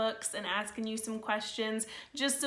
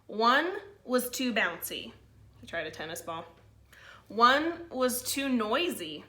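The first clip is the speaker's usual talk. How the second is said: put-on lively read-aloud to kids; storybook reading; 2 semitones higher, 4 dB louder, slower than usual. A narrator's reading. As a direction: read it like a picture book to the class. Held story narration for children.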